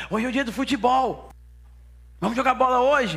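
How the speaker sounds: high-pitched, eager mock call to a friend; excited boys. Boys inviting Sem to play football, excited. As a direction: voice boys rallying a mate for football.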